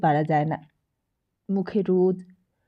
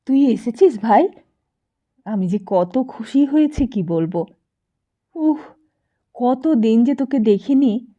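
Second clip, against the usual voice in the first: louder, higher, gushing greeting to a long-missed friend; overjoyed welcome; 3 semitones higher, 6 dB louder than usual. A narrator's reading. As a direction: pour out the joy of seeing her again. Lakshmidi embraces Sati joyfully.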